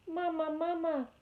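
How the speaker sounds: put-on high, tiny baby-bird cry for its mother; peeping gosling. A narrator's reading. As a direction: peep it out high like a newborn gosling. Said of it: "peeped the gosling".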